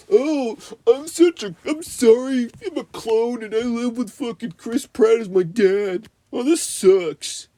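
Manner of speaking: high, whiny mock British teen accent; mocking the annoying teenager in a bad British accent. Vlogger mocking the movie's teen clone character in a high, whiny fake British accent. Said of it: "I can't do a British accent".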